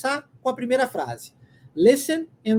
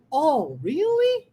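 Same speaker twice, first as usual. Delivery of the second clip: "Oh, really?" slow with a big rise; sing-song dip and climb through "Oh, really?"; marked intonation; 5 semitones higher, much slower than usual. Shown as a demonstration. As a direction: swoop "really" up high and hold it. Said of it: "olha como é que tem entonação".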